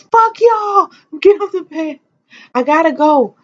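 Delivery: high, loud mock yell in retelling; frantic yelling. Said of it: "I was like".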